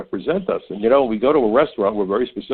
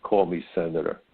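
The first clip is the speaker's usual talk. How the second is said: mock arrogant senator, lower and softer; mock haughty senator; 4 semitones lower, 6 dB quieter than usual. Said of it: "She's lucky I wouldn't call her late".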